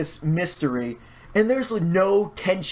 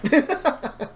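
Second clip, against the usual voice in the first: a short laugh jumping way up; high embarrassed laugh; 10 semitones higher than usual. Real, not acted. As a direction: laugh it off, a little sheepish. Laughs at sad raffle result.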